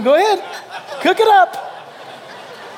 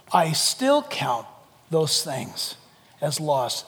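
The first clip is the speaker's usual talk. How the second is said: quiet solemn quote; 8 semitones lower, 6 dB quieter, much slower than usual. Acted.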